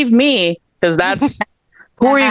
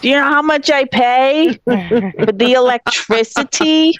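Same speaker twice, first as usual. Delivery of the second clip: put-on mom with a light Italian accent, higher; lightly voiced Italian mom fussing over bills; 6 semitones higher than usual. Japanese mom voiced with a light Italian accent nagging about electricity. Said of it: "my Japanese mom also has an Italian accent".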